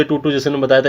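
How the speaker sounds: usual speaking voice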